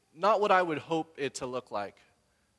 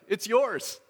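eager offer; 8 semitones higher, 6 dB louder than usual. Retold or imitated. Stranger handing over his tie.

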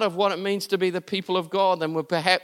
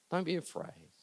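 much softer, almost breathed; hushed; 15 dB quieter than usual. Shown as a demonstration. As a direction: drop to a hush for comfort. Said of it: "what Jesus is saying to you today".

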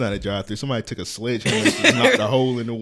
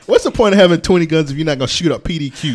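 louder indignant repeat; mock outrage, louder; 5 semitones higher, 10 dB louder than usual. Real, not acted.

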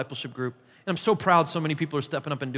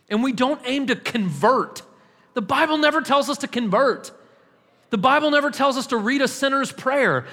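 higher, louder pulpit declaration, NEVER hit hard; preaching at full force; 6 semitones higher, 4 dB louder than usual. Acted. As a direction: proclaim it like a challenge. Preacher peaks on key claim.

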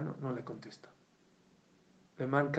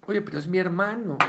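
raised, higher voice arguing at the door; angry protest; 6 semitones higher, 7 dB louder than usual. Retold or imitated. Brother arguing with the guard at the entrance, raised and heated. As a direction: insist hotly that he's family.

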